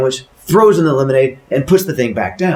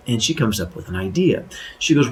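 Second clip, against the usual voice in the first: ordinary delivery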